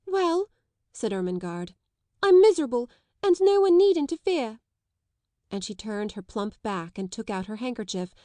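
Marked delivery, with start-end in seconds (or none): 0.1-0.5 tearful. A narrator's reading. Ermengarde tearful. 2.2-4.6 high, loud tearful cry; tearful outburst. A narrator's reading. Ermengarde crying, defiant.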